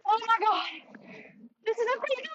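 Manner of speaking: high-pitched, stunned disbelief; gasping high excitement; excited. Real, not acted. Big fish, net broken.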